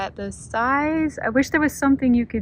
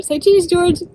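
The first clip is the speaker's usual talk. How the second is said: high and loud photo call; playful cheer; 8 semitones higher, 7 dB louder, slower than usual. Real, not acted. Asking George the gator to pose for a thumbnail, playful high call.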